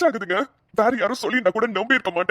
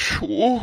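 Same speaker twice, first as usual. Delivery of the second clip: short tearful sob; a sob breaking through; low tearful breath of despair; 7 semitones lower, much slower than usual. Acted. Alone, distressed son.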